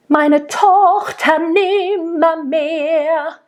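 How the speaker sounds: slow and high, overdoing the r's in Tochter nimmermehr; exaggerated rolled r in Meine Tochter nimmermehr; mock-overdone German r's; over-rolled r. Shown as a demonstration. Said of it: "that doesn't sound German anymore".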